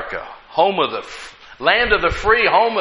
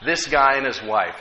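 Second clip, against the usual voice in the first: normal talking voice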